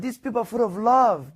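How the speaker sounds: high, loud burst of wonder; moved exclamation. Retold or imitated. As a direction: retell his amazement, high and loud. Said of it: "He said".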